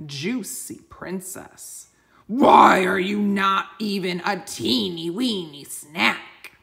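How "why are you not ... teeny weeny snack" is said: slow, loud dragon roar. Acted. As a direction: bellow it down at the tiny boy. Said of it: "he roared".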